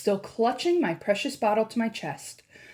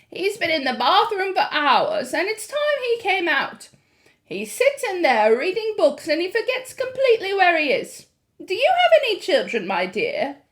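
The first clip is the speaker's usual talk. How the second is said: put-on high, easy old lady voice; a lightly voiced grandmother; 9 semitones higher, 5 dB louder than usual. A narrator's reading. Grandmother voicing.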